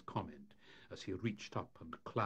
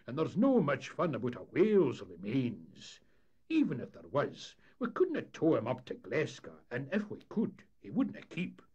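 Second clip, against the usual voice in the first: louder, lightly raised Scots character voice; doubtful skipper; 6 semitones higher, 8 dB louder than usual. Acted. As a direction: play a skipper raising practical objections. Voices Parahandy in a story.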